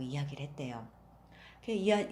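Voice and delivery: plain reading voice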